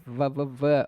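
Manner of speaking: va, va, va with a buzzing v; syllable va repeated, v held; sounding out v. Shown as a demonstration. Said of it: "não tem o b".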